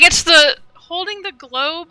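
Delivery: normal talking voice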